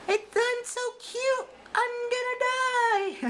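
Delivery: very high, slow squeaky bunny; cute bunny voice. Acted.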